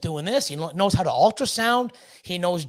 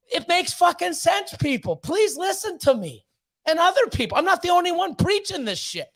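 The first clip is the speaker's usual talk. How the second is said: fired-up pleading; 9 semitones higher than usual. Real, not acted. Ranting to viewers about snake money. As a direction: plead with the audience to listen.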